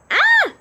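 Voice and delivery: high, very loud scream; loud startled shriek. A narrator's reading. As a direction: shriek back at the intruder. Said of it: "she screamed".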